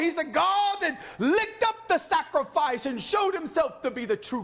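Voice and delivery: high, shouted pulpit proclamation; full-force preaching. Real, not acted. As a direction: cry it out to the congregation.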